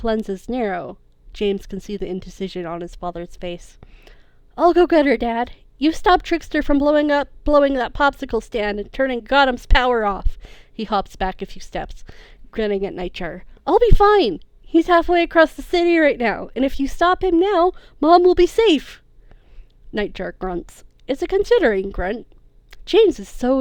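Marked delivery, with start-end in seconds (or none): 4.6-10.4 higher, louder eager son's voice; eager young hero. Acted. James urges his father, voiced. 13.7-19.0 much higher, louder confident son; cocky young hero. Acted. James reassures father, high voice.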